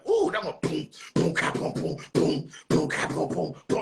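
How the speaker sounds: beatbox imitation of a hard beat. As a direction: boom ka boom mouthed like a drum. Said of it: "I'm like".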